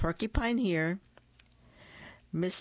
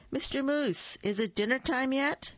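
light, higher little rabbit voice; small rabbit asking about dinner; 9 semitones higher than usual. Acted. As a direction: pipe up like an eager small rabbit.

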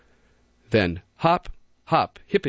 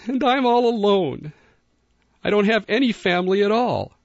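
moaning bunny; 11 semitones higher than usual. A narrator's reading. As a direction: wail the loneliness as a little rabbit. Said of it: "moaned Barrington".

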